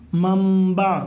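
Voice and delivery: منبع as mam-ba, m held long; nun read as mim. Shown as a demonstration. Teacher answers the class question, منبع drawn out with an m. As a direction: linger on a humming m in منبع. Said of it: "کیا پڑھیں گے".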